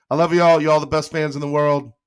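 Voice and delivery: high, loud burst of love; excited. Real, not acted. Streamer thanking his fans, excited and loud. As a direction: blurt it out to the whole chat.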